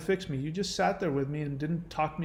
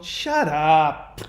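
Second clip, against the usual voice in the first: mock parent snapping shut up louder; harsh parent snap; 6 dB louder than usual. Parent shutting down scared kid, snapping. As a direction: bite the kid's head off, acted. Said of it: "but you don't go".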